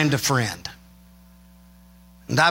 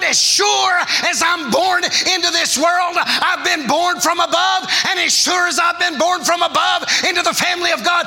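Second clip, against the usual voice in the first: full-force preaching; 9 semitones higher than usual. Acted. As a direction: lift the congregation, preach it out.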